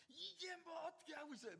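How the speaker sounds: high, loud mock scolding; hammed-up scolding of a baby; mock angry scolding. Mother mock-scolding her baby, high and loud. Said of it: "막 이렇게 합니까?".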